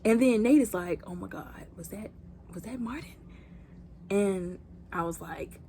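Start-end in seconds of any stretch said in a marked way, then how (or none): 1.1-3.1 near-whispered, very soft worried question; acting out shocked disbelief under the breath; hushed, rising at the end; hushed alarm. Character reacts to a gunshot.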